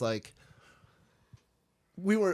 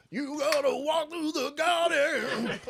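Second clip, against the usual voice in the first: high, slow, laughing put-on voice; mock cop voice; 4 dB louder, much slower than usual. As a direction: ham up a sly cop.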